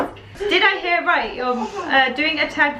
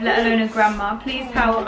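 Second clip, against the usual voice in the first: usual speaking voice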